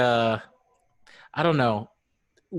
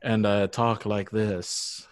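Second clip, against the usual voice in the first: slow, lower imitation of a deep voice; 4 semitones lower, slower than usual. Teasing co-host about allergy night, deep voice. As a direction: drop it low and drag it out. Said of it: "you had a deep voice".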